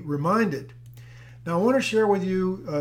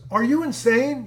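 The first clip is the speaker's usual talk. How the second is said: high, louder mock disbelief; incredulous policewoman; 7 semitones higher, 5 dB louder than usual. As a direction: act out an officer's astonished challenge. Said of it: "same thing as his wife".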